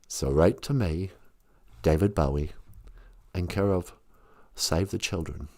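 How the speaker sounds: low ghostly rock star from beyond. Retold or imitated. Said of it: "remember when he was".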